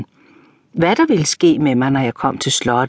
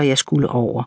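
ordinary delivery, nothing marked

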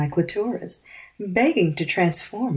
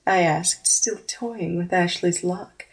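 ordinary delivery

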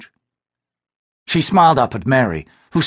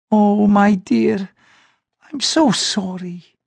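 comforting mother; 9 semitones higher, much slower than usual. Acted. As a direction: soothe a crying daughter. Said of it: "Eleanor had said".

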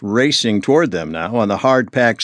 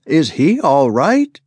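higher, louder anxious question; mother asks about the boy, higher; voice pitching higher; 5 semitones higher, 3 dB louder than usual. A narrator's reading. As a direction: let the worry push the voice up. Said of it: "her voice pitching higher".

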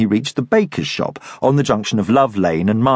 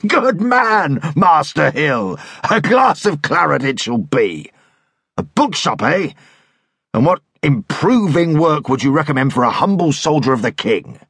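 dragoon leader; 2 semitones higher than usual. Acted. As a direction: hail the stranger like a rowdy officer. Dragoon replies.

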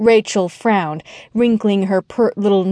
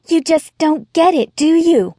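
much higher, pushed exasperation; exasperated outburst; 8 semitones higher than usual. Acted. Young woman exploding at the ex-wife, exasperated. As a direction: throw it at her, fed up. Rachel leapt up dramatically.

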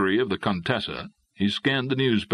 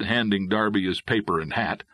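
ordinary delivery, nothing marked

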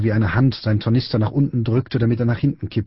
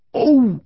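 high-pitched "oh" cry; odd impact noise; 3 dB louder than usual. A narrator's reading. Narrator imitating the strange noise Friedrich heard, high yelp. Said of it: "hörte er ein komisches Geräusch".

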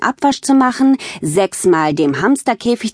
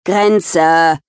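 mild growl, a little louder; growled warning; 4 dB louder than usual. Acted. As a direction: snarl the one word at her sister. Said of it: "knurrte Tiffany".

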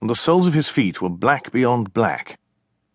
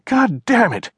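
angry cry; 14 semitones higher, slower than usual. Acted. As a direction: cry out in shocked anger. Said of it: "she cried".

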